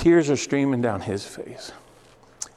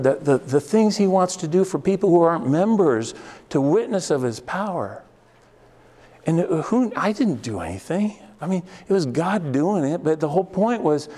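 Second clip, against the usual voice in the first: higher-pitched, fervent pulpit appeal; raised, impassioned sermon voice; earnest preaching; 5 semitones higher than usual. Real, not acted. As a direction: press the message home to the congregation. Preaches on God's goodness.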